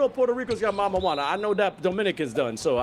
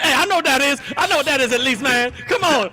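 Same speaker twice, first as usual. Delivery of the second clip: raised-voice, put-upon complaint; loud mock protest; 6 semitones higher, 8 dB louder than usual. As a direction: shout it in exasperated protest, higher and much louder. Acting out own protest at the broadcast.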